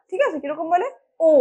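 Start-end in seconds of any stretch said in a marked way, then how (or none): 1.3-1.3 ও held long, British style; drawn-out ও. Shown as a demonstration. Teacher showing students how the British say it, drawn-out ও. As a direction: stretch out ও without stopping it short. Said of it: "ও বলে টানে ওরা".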